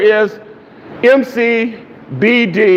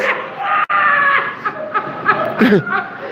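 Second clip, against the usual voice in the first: shrieking laugh; 6 dB quieter than usual. Real, not acted. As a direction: let a big laugh burst out. Laughing while retelling dream.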